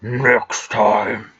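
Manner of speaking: slow, low and deliberate; a hint of a cold, condescending noble; cold villain voice. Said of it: "how is it?".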